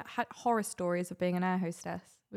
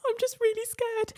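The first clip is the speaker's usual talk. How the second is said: high-pitched retold panic; frightened passenger's high little plea. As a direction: re-enact a scared plea, pitched way up. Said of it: "I was shaking, crying".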